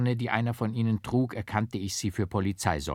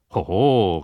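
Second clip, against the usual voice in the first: loud exclaimed "hoho"; raised-voice exclamation; called out; 9 dB louder than usual. A narrator's reading. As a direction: call it out in mild protest. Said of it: "rief der Leibarzt".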